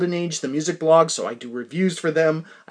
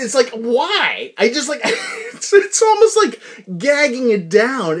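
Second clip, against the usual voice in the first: slow, high, pained complaint; exasperated disgust; 6 semitones higher, 4 dB louder, slower than usual. Real, not acted. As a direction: whine it out slowly in disgust. Forcing down bad yogurt.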